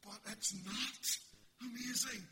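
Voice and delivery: loud, high, driving sermon voice; booming pulpit delivery; full-force preaching. Acted. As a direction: preach it hard to the back row.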